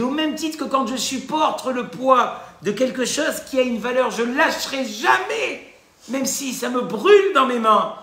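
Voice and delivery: fiery preacher's vow; impassioned preaching. Retold or imitated. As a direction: proclaim it with burning conviction. Rav's parable climax.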